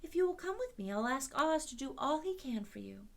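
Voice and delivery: put-on reassuring young Dorothy; little girl. A narrator's reading. Girl's lines voiced.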